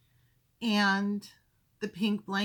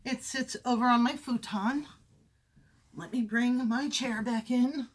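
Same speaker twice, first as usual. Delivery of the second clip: ordinary delivery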